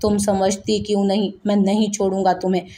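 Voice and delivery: ordinary delivery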